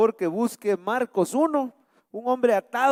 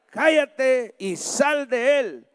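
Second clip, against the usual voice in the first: high, slow command with force; stern rebuke; 6 semitones higher, 3 dB louder, much slower than usual. Acted. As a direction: rebuke and order it out, word by word. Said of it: "Le reprendió". (reprensión firme, lenta y autoritaria)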